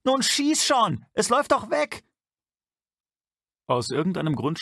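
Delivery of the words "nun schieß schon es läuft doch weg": high, loud desperate scream; young man screams at the gunman to fire. A narrator's reading. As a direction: yell at the top of your lungs. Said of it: "schrie aus Leibeskräften".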